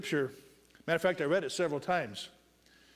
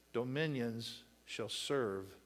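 lower, softer, slow; soft slow close; 5 semitones lower, 8 dB quieter, much slower than usual. Acted. Preacher finishing the reading, soft and slow. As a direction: let the verse settle down quietly. Closing verse fades down.

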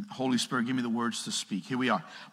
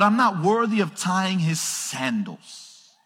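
slow, lightly preached humble line; John the Baptist; 7 semitones higher, 5 dB louder, slower than usual. Acted. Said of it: "John the Baptist said".

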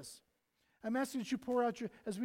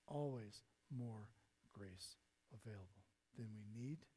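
much softer and lower, slowed right down; hushed slow echo; 11 semitones lower, 13 dB quieter than usual. Shown as a demonstration. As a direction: repeat it as a quiet promise. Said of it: "Because there's always more grace available".

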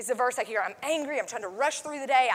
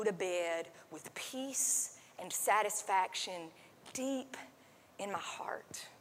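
hushed, breathy whisper of longing; 5 semitones lower, 10 dB quieter, slower than usual. Real, not acted. Pastor confides his deepest wish to the congregation, whispering. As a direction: drop to a whisper as you confide it. Heartfelt close, voice drops to whisper.

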